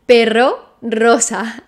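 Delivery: 'perro' and 'rosa' with a rolled, trilled rr. Shown as a demonstration. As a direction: roll the r hard in 'perro'. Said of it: "and double R, called thrilled".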